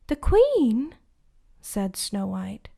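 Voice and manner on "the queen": high, loud shocked question; surprised. Acted. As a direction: gasp the words out in disbelief.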